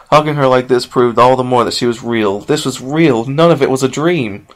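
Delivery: usual speaking voice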